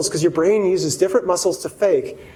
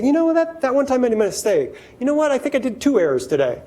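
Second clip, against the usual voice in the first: casual admitting; 6 semitones higher than usual. Retold or imitated. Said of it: "safe enough to say".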